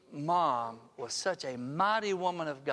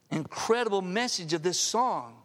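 ordinary talk

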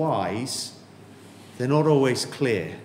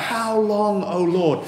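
raised cry of how long; 5 semitones higher, slower than usual. Retold or imitated. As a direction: lift the cry up to the Lord. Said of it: "he cries out".

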